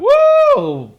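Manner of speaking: high, loud whoop with a laugh; excited whoop. Real, not acted. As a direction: cheer and crack up laughing. Cheering at hot actor photo.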